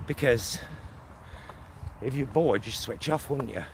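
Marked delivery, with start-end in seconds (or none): none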